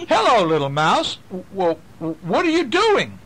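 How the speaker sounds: high, loud teasing greeting; playful bear voice. Acted. Reading a picture book to kids, voicing a playful high greeting. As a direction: coax the little mouse sweetly.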